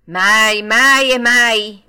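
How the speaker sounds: loud, slow, drawn-out denial; emphatic assurance. A narrator's reading. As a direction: hammer each word with full conviction.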